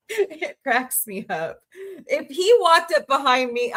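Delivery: ordinary delivery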